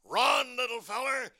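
cartoon bulldog calling out, pitched high; big cartoon dog's urgent call; Chopper. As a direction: urge the little duck on. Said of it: "Chopper tells him to run".

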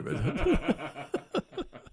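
real amused chuckle; laugh. Real, not acted. Veteran joking with interviewer after fishing for a compliment, laughing. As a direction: laugh at your own joke.

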